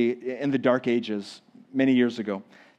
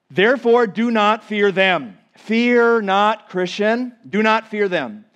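rising, emphatic call not to fear; forceful preaching; 6 semitones higher, 5 dB louder, slower than usual. Acted. Preacher urging the church not to fear, emphatic. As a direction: press it on the congregation.

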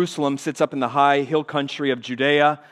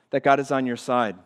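ordinary talk

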